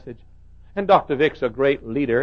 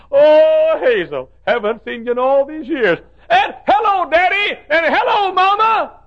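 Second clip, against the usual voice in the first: high, loud happy hellos; joyful greeting; 9 dB louder, slower than usual. Retold or imitated. Mother greeting family in heaven, joyful hellos. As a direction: greet family long missed.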